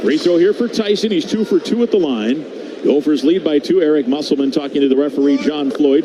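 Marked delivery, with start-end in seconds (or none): none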